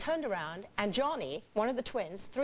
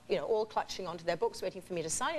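usual speaking voice

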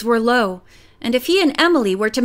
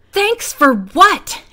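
slow, loud, higher angry snap; stormy retort; 6 semitones higher, 5 dB louder, much slower than usual. A narrator's reading. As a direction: hurl the question back in anger. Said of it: "Emily stormed".

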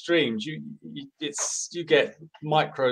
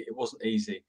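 it wasn't easy, said much softer and falling; quiet rueful repeat; 6 semitones lower, 10 dB quieter than usual. Real, not acted. Director recalls his campaigns to the host, quiet rueful echo. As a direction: let "It wasn't easy." drop away under the breath.

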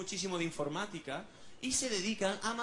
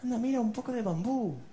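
higher, louder coaxing tone; cheery; 5 semitones higher, 4 dB louder than usual. Acted. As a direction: tempt the panda with a treat. Cheering up the panda. (tono animado para animar al oso)

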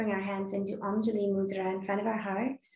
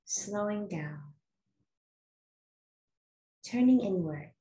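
guided meditation. Acted. Yoga teacher settling the class into natural breath, calm guided meditation. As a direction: guide them gently inward, phrase by phrase.

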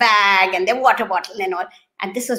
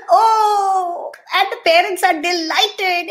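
high, excited delight; a joyous exclamation; 9 semitones higher, slower than usual. Retold or imitated. Parents thrilled at the first word, high excited delight.

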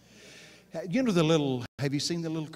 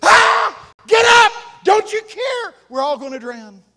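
much higher, much louder panicked yell; terrified screaming; 16 dB louder than usual. Retold or imitated. Disciples scream at the sleeping Jesus in terror, shouting. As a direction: scream for your life at the sleeping man. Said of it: "they start screaming".

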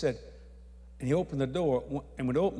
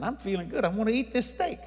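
higher-pitched, emphatic insistence; emphatic protest; 7 semitones higher, 3 dB louder than usual. Retold or imitated. Telling the pastor he isn't sick, emphatic protest. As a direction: insist you're fine, voice lifting. Reassuring worried host at lunch.

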